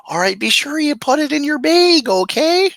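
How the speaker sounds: very high, loud mock Minnesotan; Minnesota accent. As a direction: chirp it like an exaggerated Minnesota platoon leader. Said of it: "Minnesota, so she'd be like".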